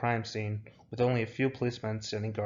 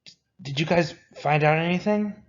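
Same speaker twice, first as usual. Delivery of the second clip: high, loud, stammered nervous question; jittery and stammering, pitch up; anxious; 7 semitones higher, 6 dB louder than usual. A narrator's reading. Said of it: "twitches from his extreme anxiety, causing him to gasp".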